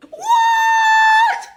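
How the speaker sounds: very high drawn-out WHAT; outraged shriek. Acted. Teacher reacting to a pupil's yes, outraged. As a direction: shriek it in sheer disbelief.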